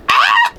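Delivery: short shocked squeal, very high; high shriek. Real, not acted. Reader shrieking over a character's death. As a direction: yelp of disbelief.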